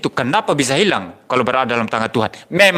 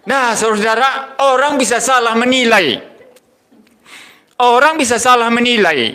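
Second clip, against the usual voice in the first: raised, higher preaching voice; 5 semitones higher than usual. Acted. Preacher urging the congregation that only God never errs. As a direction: press the lesson home to the congregation. Preacher drives home the point.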